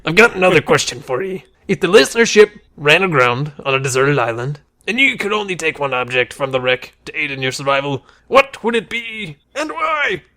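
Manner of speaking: hammed-up dwarf with a touch of pirate; Gimli. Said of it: "I'll be Gimli".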